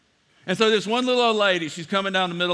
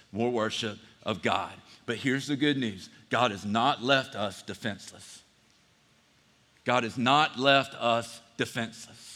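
hushed earnest preaching; 6 semitones lower, 6 dB quieter than usual. Acted. Urgent lower preaching.

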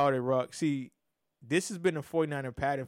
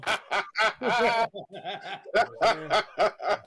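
real laughter; 4 semitones higher than usual. Real, not acted. Host cracking up at his own joking threat, real laughter. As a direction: crack up in a run of high laughs.

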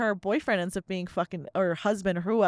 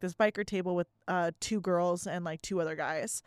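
ordinary delivery

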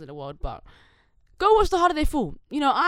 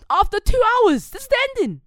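high, loud incredulous outburst; mock outrage; 14 semitones higher, 11 dB louder than usual. Viewer protesting a film's ending, in loud mock outrage. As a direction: shout it high, appalled at the letdown.

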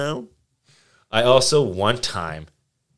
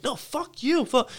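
mock outburst; slower than usual. Retold outburst high voice.

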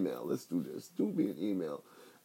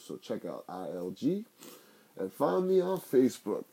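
normal talking voice

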